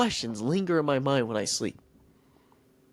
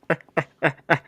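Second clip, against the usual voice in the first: villainous giant's staccato laugh; mocking laugh; 2 semitones higher than usual. Goliath jeering at the scared army, mocking cackle. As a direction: laugh scornfully at weaklings. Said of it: "Goliath was just making fun of them".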